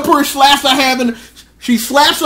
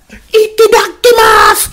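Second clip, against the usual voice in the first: squeaky high, louder anime-style cheer; falsetto itadakimasu; 13 semitones higher, 6 dB louder than usual. Retold or imitated. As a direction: squeal it like a cartoon kid. Characters say grace comically.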